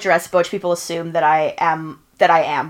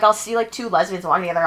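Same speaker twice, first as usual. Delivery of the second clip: usual speaking voice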